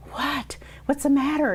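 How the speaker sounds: high, worried "what's the matter"; startled patient asking the scan staff what is wrong; alarmed question. Retold or imitated. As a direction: ask anxiously, voice jumping up.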